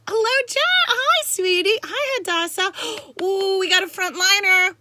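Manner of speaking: high, loud squealed hellos; excited greeting. Real, not acted. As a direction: greet arriving friends with loud, high delight. Greets new viewers joining the live.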